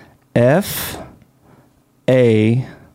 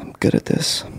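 whisper; 7 dB quieter than usual. Real, not acted. Joking under breath.

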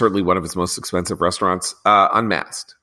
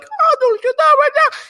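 much higher put-on Greek accent; mock Arianna Huffington; 6 dB louder than usual. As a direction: parody a thick Greek accent, pitched way up. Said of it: "her thick Greek accent".

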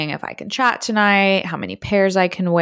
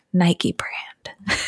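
whispered tease; 4 dB quieter than usual. Retold or imitated. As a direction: whisper the brand with a breathy giggle. Playful reply in his chat.

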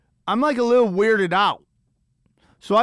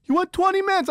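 higher, mock-exasperated demand; a touch of an irritated boss; annoyed booker; 9 semitones higher than usual. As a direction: booker scolding the comic for running long, mock annoyed. Booker yelling after bad set.

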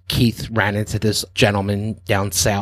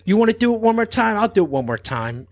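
escalating challenge; 11 semitones higher than usual. Retold or imitated. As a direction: build the dare into a shout. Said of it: "And then she goes".

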